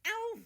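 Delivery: sharp high ow when hit; yelp of pain. A narrator's reading. Russia hit on the head, sharp yelp. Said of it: "rubs the back of his head".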